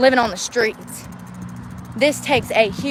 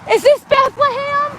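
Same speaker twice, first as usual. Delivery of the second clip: much higher, slow, mock childlike question; cartoonish high voice, drawn out; silly put-on passenger voice; 4 dB louder than usual. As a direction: play a whiny kid in the back seat. Mock road-trip passenger voices.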